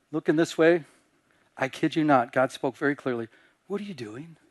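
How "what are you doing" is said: soft, questioning voice of God; quiet divine challenge. Retold or imitated. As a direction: ask it softly, like an inner voice. God quietly challenging him in church.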